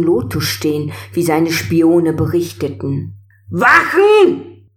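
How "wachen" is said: high, loud command shout; forceful call. A narrator's reading. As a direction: bark the order across the hall. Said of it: "rief Ming mit kräftiger Stimme".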